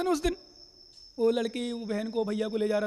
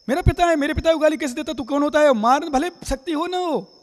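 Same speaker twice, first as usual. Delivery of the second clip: acted indignant son; 3 semitones higher, 4 dB louder than usual. Son confronting someone who abused his father, acted indignant. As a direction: act out bristling at an insult to father. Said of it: "तुरंत लड़ मरते हो".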